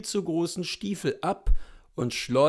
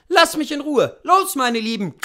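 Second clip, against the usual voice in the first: loud, high-pitched shout; raised, driving coachman's cry; calling out; 10 semitones higher, 8 dB louder than usual. A narrator's reading. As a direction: call out sharply to urge horses on. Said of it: "rief er den Pferden zu".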